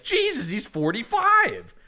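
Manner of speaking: high, slow, appalled exclamation; mock disbelief. Acted reaction to actor's outfit.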